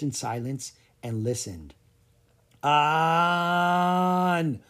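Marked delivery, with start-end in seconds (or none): 2.6-4.6 long, drawn-out eerie wail; sustained haunting call, held on one note; ghostly wail. A narrator's reading. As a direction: stretch the vowel out like a wailing ghost. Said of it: "wailed a voice".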